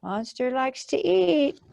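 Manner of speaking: plain reading voice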